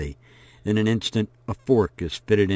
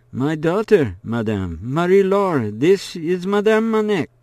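lightly raised voice for the father; a polite father making introductions, higher; voiced father; 8 semitones higher, 3 dB louder than usual. A narrator's reading. Father introducing his daughter.

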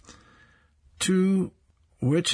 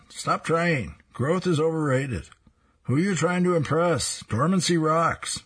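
put-on teen lecturing lawn; 7 semitones higher than usual.

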